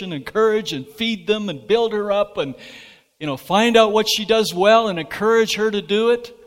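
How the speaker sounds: full-force preaching. Real, not acted. Pastor tells married couples of Eden, in rising fervent preaching. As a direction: preach it out, climbing to a peak.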